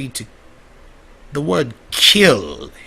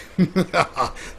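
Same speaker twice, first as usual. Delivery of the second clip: evil laugh; 6 semitones higher than usual. Acted. Villainous character gloats.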